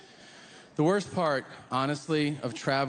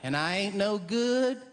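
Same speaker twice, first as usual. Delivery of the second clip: a hammed-up Southern lady feeling sorry for herself; 4 semitones higher than usual. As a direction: whine it like a sad country woman. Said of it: "some lady might go".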